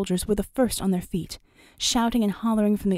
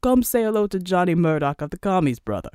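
lightly voiced islander calling brother over; big Samoan islander; 3 dB louder than usual. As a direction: play a friendly big Samoan. Islander calls his brother over.

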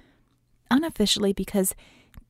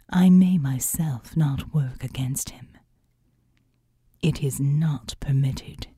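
solemn seraph; 5 semitones lower than usual. Acted. The seraphim's long speech.

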